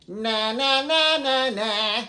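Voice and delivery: high loud focused na na na; bright witchy nas; nasal na exercise. Shown as a demonstration. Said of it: "Other vocal coaches will tell you, go".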